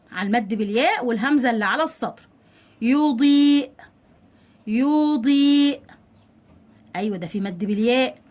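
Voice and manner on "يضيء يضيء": louder, long held vowels; drawn-out model word. Shown as a demonstration. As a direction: dictate it very slowly to pupils. Teacher models example word twice. (نطق ممدود للكلمة)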